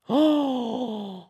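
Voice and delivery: long, high "oh" of shock; shocked gasp. Real, not acted. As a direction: gasp it out, scandalised. Still reacting to the rumour.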